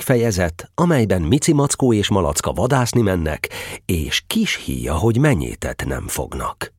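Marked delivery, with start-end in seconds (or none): none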